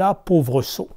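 loud furious nagging wife; wife yelling orders at husband; angry shout. Acted. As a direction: scream at the foolish husband. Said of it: "la femme crie de plus belle".

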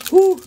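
a sharp high whistling exhale of relief; loud high whew. Real, not acted. Catching his breath after a huge pull, loud whew. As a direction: blow it out loud like you just survived.

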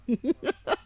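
short amused laugh; real chuckle. Real, not acted. Teacher joking about a cooking competition, amused chuckle. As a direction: chuckle lightly at your own joke.

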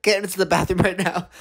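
high, quick mock command; retold urgent order. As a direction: order a friend off, laughing midway. Retells ordering friend to bathroom.

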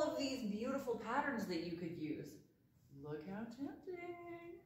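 coaxing a friend to use a pattern, teasing and slow; teasing temptation. Acted. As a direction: dangle it like a treat, slow and soft.

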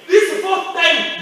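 stretched pulpit exclamation; preaching build. Acted. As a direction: drag it out for effect. Preaching climax, slow loud.

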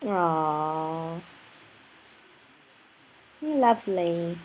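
soft, low and slow pet talk; tender cooing. Real, not acted. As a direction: coo gently to your pet. Softly cooing to pet parrot.

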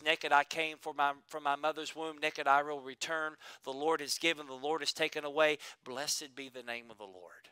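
soft, low, hushed surrender; reverent Job. Retold or imitated. Job answering his wife, soft and reverent. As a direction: quietly yield it all to the Lord. Job's reply to his loss.

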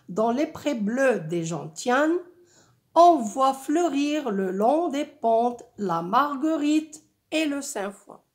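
projected, well-articulated verse recitation; poetic reading. Shown as a demonstration. Teacher recites the flowering meadow verses to pupils, poetic reading. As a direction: lift the voice and recite in poetic rhythm. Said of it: "un rythme et un ton, euh, poétique".

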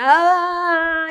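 long, high, loud mock cry; imitated shout. Shown as a demonstration. Teacher showing the chant as a shout, long mock cry. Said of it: "como se fosse um grito".

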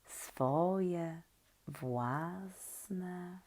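ordinary delivery, nothing marked

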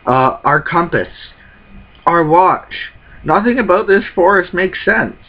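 a hint of frightened anime sidekick; scared crew voice. Crew member urges everyone back to Luffy, mock panic.

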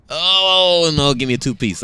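a high, loud "Oh" dropping into a joking correction; excited joke. Retold or imitated. Playfully correcting the owner's offer, high excited "Oh". Joking reply about chicken order.

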